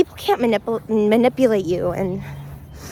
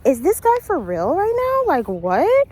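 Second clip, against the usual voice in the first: imitating her own stunned reaction, higher; incredulous; 7 semitones higher, slower than usual. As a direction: pitch jumps high in retold disbelief. Disbelief at date not paying.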